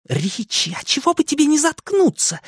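high-pitched annoyed hiss; hissed rebuke. A narrator's reading. Girl tells the joker to shut up, hissing. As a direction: cut him off, hissing, without raising volume. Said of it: "зашипела на него Беверли".

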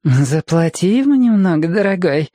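put-on high-pitched coaxing drunk lady; tipsy feminine purr; purring woman. A narrator's reading. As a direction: purr it up high to your sweetheart. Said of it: "промурлыкала женщина".

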